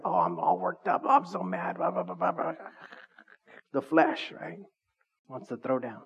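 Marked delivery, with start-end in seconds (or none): 0.0-2.5 hammed-up fuming, pitch jumping up and down; mock angry rant. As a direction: play the flesh grumbling in an overdone way. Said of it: "You could say, like".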